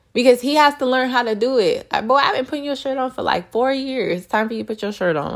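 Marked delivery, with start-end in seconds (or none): 2.1-5.3 rushed, higher mock scolding; mock scolding mom. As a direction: rattle it off like a teasing mom. Mom scolding son, retold.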